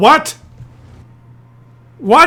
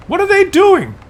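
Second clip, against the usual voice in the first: much higher, stunned disbelief; incredulous cry; 10 semitones higher, 3 dB louder than usual. Real, not acted. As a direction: ask it in total bafflement. Incredulous at replay.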